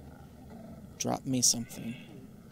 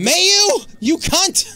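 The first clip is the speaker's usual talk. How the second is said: furious scream; 12 dB louder, slower than usual. Real, not acted. Outraged at enemy.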